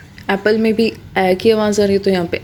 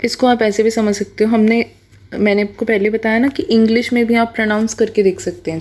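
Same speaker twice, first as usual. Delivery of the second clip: normal talking voice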